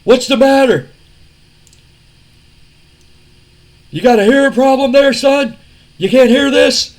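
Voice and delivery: loud, high taunting wrestler voice; Austin taunting. Retold or imitated. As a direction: needle him like a smug tough guy. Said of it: "Austin looks at Shane and says".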